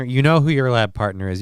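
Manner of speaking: normal talking voice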